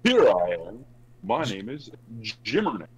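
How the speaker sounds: loud, corny put-on introduction; Jimmer impression. Shown as a demonstration. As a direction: announce yourself in a hammy goofy voice. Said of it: "It's a corny voice".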